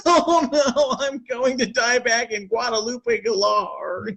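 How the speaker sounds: high put-on voice, slowing into a drawn-out moan; mock dying old person. Caller mocks a sick elder fearing death abroad, hammed-up whine. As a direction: ham up a dying elder's lament, slow. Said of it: "They're like".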